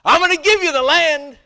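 high, loud, proclaiming; booming voice of God. Retold or imitated. God to Joshua, a loud booming promise. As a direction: announce it from above. God speaking in retold scripture.

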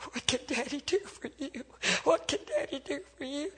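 put-on high, strained plea to a dying child; anguished father. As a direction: plead tenderly in a high, grieving voice. Father speaks to his dying son.

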